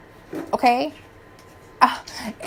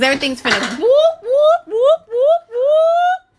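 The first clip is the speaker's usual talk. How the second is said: playful whoops; 13 semitones higher, slower than usual. Pretend. Playful whooping crumble noise.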